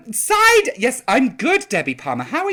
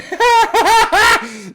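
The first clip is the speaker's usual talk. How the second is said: loud high laugh; 11 semitones higher, 9 dB louder than usual. Real, not acted. Laughing to viewers about one drink too many, loud high laugh. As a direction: burst out in a loud, high cackle.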